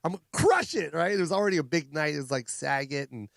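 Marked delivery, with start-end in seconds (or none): 0.1-0.9 hyped-up repeat. Retold or imitated. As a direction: psych yourself up, punching crush.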